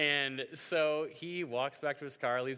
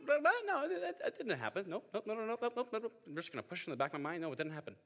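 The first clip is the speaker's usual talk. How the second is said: high nervous voice, nope after nope; hammed-up squeaky denial; mock denial; 10 semitones higher, faster than usual. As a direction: play the guilty husband faking it never happened. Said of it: "try to be like".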